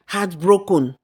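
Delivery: usual speaking voice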